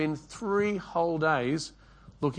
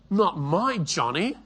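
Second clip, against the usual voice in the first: higher, slow, indignant mock mother; defensive mother; 5 semitones higher, 3 dB louder, slower than usual. Mother answers the school principal, indignant and higher. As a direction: play the mum who won't believe it. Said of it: "and the mother says".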